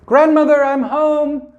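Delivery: put-on high, bright call of a little girl. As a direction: call out high as a child arriving. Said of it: "let herself in".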